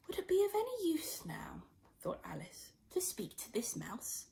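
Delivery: soft whisper to herself; whispered thought. Acted. Alice whispering her thought about the mouse. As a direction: whisper it like a secret.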